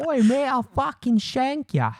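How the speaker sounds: very high, loud mock English thug; English accent. Shown as a demonstration. Showing off an English accent, mock street threat. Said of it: "my English accent is".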